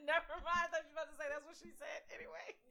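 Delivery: high laughing voice, fast. Real, not acted. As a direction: laugh through the words. Laughing at a dirty joke.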